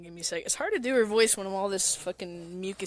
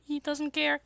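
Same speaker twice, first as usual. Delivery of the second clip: put-on a bit higher boy voice; lightly voiced Len; 8 semitones higher than usual. Len brushing Miku off, lightly voiced. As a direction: shrug it off as a Vocaloid boy. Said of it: "it's Len".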